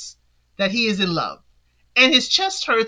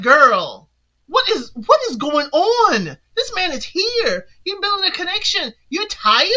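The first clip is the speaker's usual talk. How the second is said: higher, incredulous mock telling-off; exasperated scolding; 6 semitones higher than usual. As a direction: scold her in high-pitched disbelief, hammed up. Mock scolding a tired contestant.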